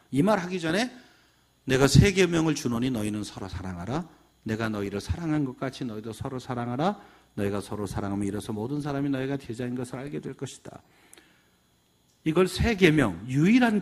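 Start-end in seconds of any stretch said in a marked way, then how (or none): 1.6-10.8 low, hushed, even recital; scripture recitation. Acted. Jesus telling disciples to love one another, quoted in subdued recitation. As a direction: recite it solemnly in a lower, softer voice.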